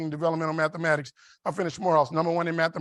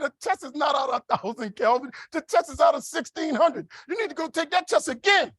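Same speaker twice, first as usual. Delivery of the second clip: mock scolding counselor; 8 semitones higher than usual. Counselor scolding in retold story.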